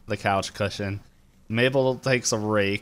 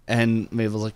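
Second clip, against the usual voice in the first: ordinary talk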